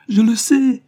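the second je le sais climbs into a sobbing wail, sais hit hard; tearful cry. A narrator's reading. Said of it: "en versant d'abondantes larmes".

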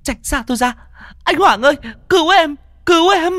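high, loud panicked cry for help; terrified scream. Acted. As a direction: scream as the ghost closes in.

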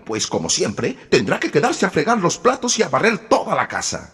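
high, loud gloating stepmother; wicked stepmother. A narrator's reading. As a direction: gloat with cold scorn. Stepmother gloating about Cinderella.

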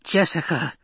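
high-pitched, contained storyteller's scream. A narrator's reading. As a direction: cry out the name as an old woman's scream. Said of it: "the old woman screamed".

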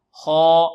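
خا loud, held on one note; thick, sustained خا for repetition; heavy letter drill. Shown as a demonstration. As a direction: lead the class with a dark, long خا. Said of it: "انطقوا معي".

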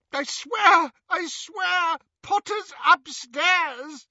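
very high wailing oath; desperate plea. A narrator's reading. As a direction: plead frantically, voice cracking upward. Tortured father pleads to be believed.

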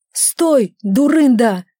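sharp warning shout. Acted. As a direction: stop her with a yell. Henley stops her at the water. (окрик)

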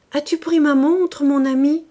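high, loud, worried cry; anxious wife. A narrator's reading. Madame Rastoil asking her husband about her watch, high anxious cry. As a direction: fret aloud about the watch in a panic.

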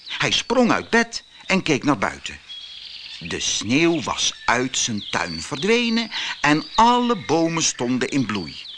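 usual speaking voice

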